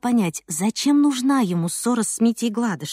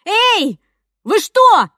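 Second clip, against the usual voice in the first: put-on high, louder ringing girl's shout; 10 semitones higher, 7 dB louder, much slower than usual. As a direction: cry out to break up the fight. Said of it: "Голос у неё был звонкий".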